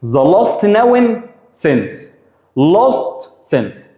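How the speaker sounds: the last noun since spelled out very slowly; very slow recap. Shown as a demonstration. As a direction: dictate it word by word. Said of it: "يبقى خلي بالك". (إملاء بطيء جدًا)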